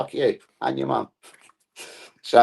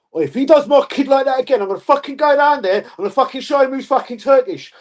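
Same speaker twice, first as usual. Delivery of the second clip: loud, high-pitched threatening rant; mock angry parent; 12 semitones higher, 5 dB louder than usual. Parent threatens whoever fouled his kid, mock angry rant. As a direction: rant like an enraged touchline parent.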